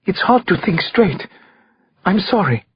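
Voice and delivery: understated, holding back the tears; fighting back tears. A narrator's reading. Shaken witness apologising to the detective, fighting back tears. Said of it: "she fought back the tears".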